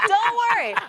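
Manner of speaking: real laugh, high, into "Don't worry". Real, not acted. [name] laughing at Shannon's joke, reassures him while laughing.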